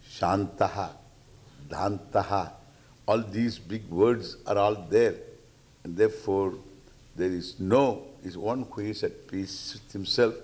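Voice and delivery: normal talking voice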